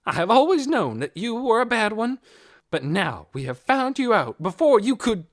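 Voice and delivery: loud, high indignant scolding; indignant accusation. A narrator's reading. Missus Beeson denouncing Hoyt before the police, indignant. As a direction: denounce him to his face.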